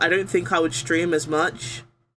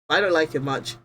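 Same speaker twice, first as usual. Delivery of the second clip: ordinary delivery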